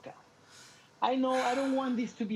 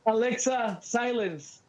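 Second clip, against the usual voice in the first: loud firm command to Alexa; 3 semitones higher, 5 dB louder, slower than usual. Real, not acted. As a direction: order the device to go silent. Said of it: "There you go".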